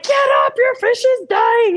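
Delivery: high, loud urgent alarm; urgent shout. Retold or imitated. Inner voice imagined yelling at her to get up, high urgent shout. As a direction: yell the warning urgently. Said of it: "it wasn't like".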